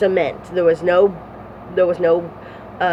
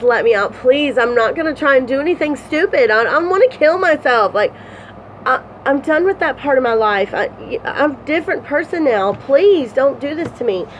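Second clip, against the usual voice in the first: begging; 7 semitones higher than usual. Retold or imitated. Begging the guards in retold scene.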